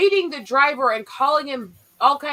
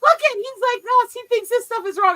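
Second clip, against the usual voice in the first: high, excited mock whine; imitating the teacher; 10 semitones higher, 5 dB louder than usual. Mocking the vegan teacher's reaction video: high mock voice. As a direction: squeal it like she thinks she's caught him out. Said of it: "And she's like going".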